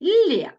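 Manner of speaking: подовжений л у слові Лє; lengthened l. Shown as a demonstration. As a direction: stretch the л in Лє. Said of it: "л подовжений".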